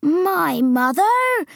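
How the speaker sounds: high, long-drawn giant's cry; astonished cry. A narrator's reading. Giant asked about his mother, crying out astonished. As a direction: cry out in disbelief, stretching it. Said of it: "cried the B F G".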